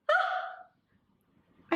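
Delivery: short, high, breathy frightened gasp; startled, airy little shriek; shriek of fear. Acted. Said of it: "I shrieked with fear".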